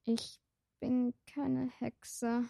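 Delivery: soft, slow and small; barely dares to correct him; timid protest. Acted. Shy vampire girl denying she is a witch, softly.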